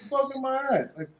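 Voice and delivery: high, bewildered rambling after a hit; high dazed voice of a hit fighter; dazed confusion. Retold or imitated. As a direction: play it punch-drunk and lost. Dazed boxer after getting hit.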